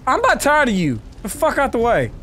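high-pitched, fed-up talking back; mock defiance. Player answers back to Hank for the android, mock defiance. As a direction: tell Hank off in playful indignation.

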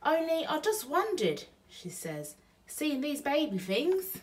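put-on Betty probes Bill about the baby things, in a high voice; Burglar Betty. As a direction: ask lightly, fishing for an answer. Betty's voice in the story.

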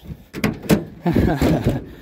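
unvoiced breathy chuckle; breathy laugh. Real, not acted. Laughing at himself.

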